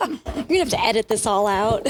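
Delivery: laughing through a cough; throat still catching; coughing on dust. Real, not acted. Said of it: "I just, like, inhaled dust".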